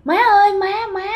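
high, pleading call after her mother. Retold or imitated. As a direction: call out desperately as she flies away. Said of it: "thì mẹ kêu".

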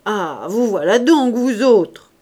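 loud fond scolding of her daughters; a loud, adoring mock scolding; doting scolding. A narrator's reading. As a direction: scold them, but full of adoration. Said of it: "d'un ton grondeur, qui était plein d'adoration".

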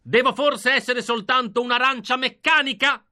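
loud, very high anguished shout; loud high desperate shout. Acted. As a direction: cry it out over the crowd. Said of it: "scricciai ancora più forte".

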